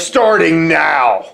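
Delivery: slow, stretched-out wrestler threat; very slow mock wrestling bravado; Hunter Hearst Helmsley. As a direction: draw the words out like a cocky promo. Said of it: "about that impression".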